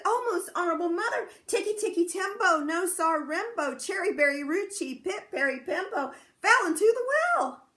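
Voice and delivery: put-on high, loud, hurried little boy telling news; breathless urgent child. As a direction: rush it out to mother, high and loud. Chang hurrying to tell mother.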